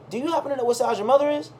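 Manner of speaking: high, fast eager voice; chirpy saleswoman. Retold or imitated. Shop lady quizzing the boy about his mother, high and quick. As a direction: pitch it up and rush it like a keen shop lady.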